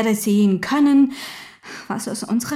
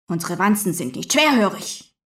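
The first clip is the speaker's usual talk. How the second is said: ordinary delivery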